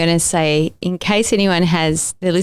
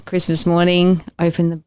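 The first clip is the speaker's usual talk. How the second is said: ordinary talk, nothing marked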